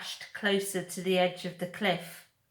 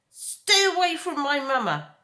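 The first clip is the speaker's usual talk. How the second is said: higher, louder gosling cry; protective shout; 8 semitones higher, 7 dB louder than usual. Acted. Gosling Brightbill shouting at the bears, higher and louder. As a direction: shout to defend mama. Gosling defends his mother.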